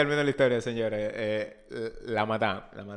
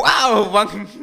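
high, loud mock amazement; mock wow; 5 semitones higher, 6 dB louder than usual. Teasing his mom's story with a mock wow. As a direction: fake being impressed by mom. Mocking mother's good escape story.

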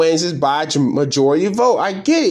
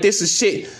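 usual speaking voice

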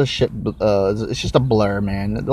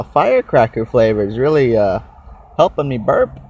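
usual speaking voice

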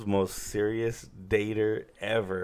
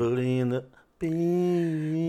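slow hype title call; 6 semitones higher, slower than usual. Acted. Drawn-out show title.

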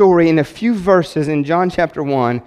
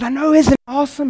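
gleeful; 9 semitones higher than usual. Retold or imitated. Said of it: "I said".

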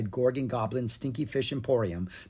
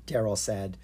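plain reading voice

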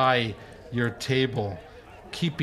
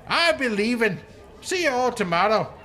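cheeky halfling goodbye; 9 semitones higher, 3 dB louder than usual. Acted.

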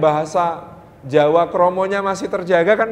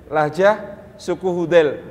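normal talking voice

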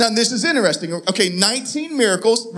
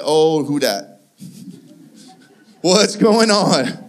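drawn-out, goofy bewildered voice; mock bewilderment; 4 semitones lower, much slower than usual. Disciple spots Jesus walking on water, mock bewilderment. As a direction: stretch it out as a clueless disciple.

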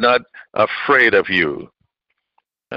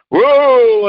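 a sudden high, loud whoa; 10 semitones higher, 5 dB louder than usual. Real, not acted. Preacher marvels at Goliath, loud high whoa. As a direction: burst out in amazement.

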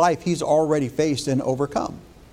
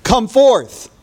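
calling out, rising to a loud high cry on come forth; 5 semitones higher, slower than usual. A narrator's reading. Jesus calling dead Lazarus from the tomb, loud cry. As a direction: call him out of the grave with full voice. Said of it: "he cried with a loud voice".

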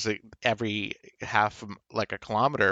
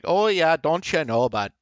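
higher, louder hammed-up Canadian accent; 6 semitones higher, 4 dB louder than usual. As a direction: parody Canadian small talk with a punchy lift. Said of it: "Canadians and how we talk like".

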